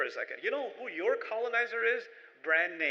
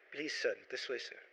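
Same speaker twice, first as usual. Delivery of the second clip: soft, low, obsequious waiter; bow and scrape like a servile host; a waiter fawning over an English speaker, softly; 7 semitones lower, 7 dB quieter than usual. Retold or imitated. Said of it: "the waiter might look at you like".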